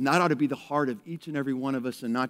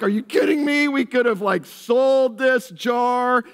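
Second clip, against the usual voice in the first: high, loud outraged protest; indignant Judas; 7 semitones higher, 6 dB louder than usual. Retold or imitated. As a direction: scoff at the waste, pitched up and louder. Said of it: "Judas especially is like".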